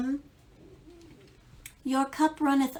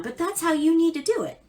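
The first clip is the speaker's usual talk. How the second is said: higher, louder and fast, driving the point home; emphatic; 7 semitones higher, 5 dB louder, faster than usual. Real, not acted. Tarot reader to viewer, emphatic and quick. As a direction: insist on it with punch.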